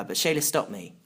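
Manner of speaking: ordinary delivery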